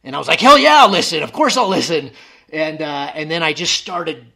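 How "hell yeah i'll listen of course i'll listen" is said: much higher and louder, a burst of desperate eagerness; eager yes. Retold or imitated. Answering the voice that offers to save him, eager shout. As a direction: shout your yes like a lifeline.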